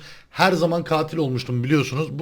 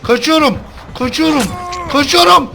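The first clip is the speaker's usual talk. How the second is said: high, loud panicked yelps; panicked yelling; 7 semitones higher, 7 dB louder than usual. Real, not acted. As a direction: scream while fleeing for your life. Killer chasing S0, heartbeat heard. (panik içinde bağırarak)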